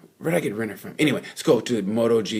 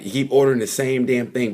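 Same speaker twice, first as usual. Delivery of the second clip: ordinary delivery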